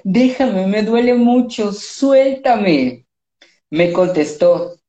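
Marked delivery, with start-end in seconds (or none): 0.0-3.1 pitched up, a hammed-up tearful cry; half-acted weepy yell; mock crying plea. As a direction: beg like a soap-opera victim. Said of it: "Yo le grité llorando".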